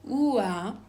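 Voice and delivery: Ua as one slow glide from u to a; isolated diphthong. Shown as a demonstration. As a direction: glide Ua out on its own. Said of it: "I am going to pronounce".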